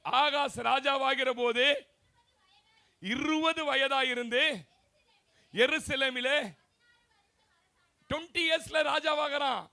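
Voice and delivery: loud, slow, proclaiming the verse to the church; preaching at full force. Acted. Preacher proclaiming the king's age to his congregation, loud and slow. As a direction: thunder each phrase of scripture out slowly.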